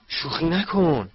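high, slow, drawn-out disbelief; disbelieving. Acted. Ron hears the wand is lost, disbelieving. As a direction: stretch it out as if unable to believe it.

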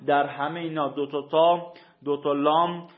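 usual speaking voice

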